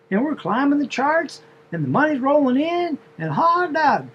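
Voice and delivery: high, lilting southern drawl telling her story. Retold or imitated. The wife bragging to the driver in a southern drawl. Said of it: "this is the way she was talking".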